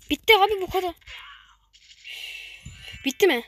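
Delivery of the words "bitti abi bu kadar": excited cheer. Real, not acted. Relief as level ends.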